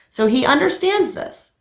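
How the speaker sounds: So he understands this with pitch jumping high on understands; confident claim. Real, not acted. Vlogger telling viewers her husband agreed, high and confident. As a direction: assert it brightly, sure of herself. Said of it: "I hope he understands this".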